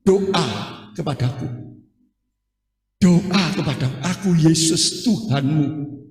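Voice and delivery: slow, calm voice of the Lord; voice of Jesus. Retold or imitated. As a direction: speak slowly as Jesus calling. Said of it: "saya mendengarkan suara". (suara Yesus yang pelan dan tenang)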